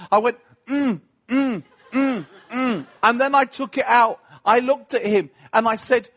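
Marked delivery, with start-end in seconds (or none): 0.7-2.9 mock straining. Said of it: "I made a big meal of it".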